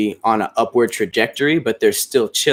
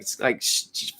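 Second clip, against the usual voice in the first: ordinary delivery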